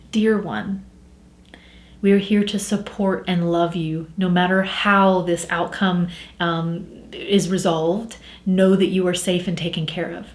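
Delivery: soft, low, soothing guide voice; gentle, hushed spirit-guide message; guide's loving message. Acted. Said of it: "that's not how I talk to myself".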